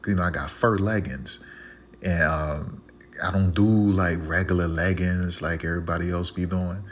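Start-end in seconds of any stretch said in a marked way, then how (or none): none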